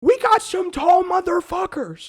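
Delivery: high, loud exclamation; excited boast. Real, not acted. Boasting to co-host about tall friends, loud and excited. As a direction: belt it out with emphatic pride.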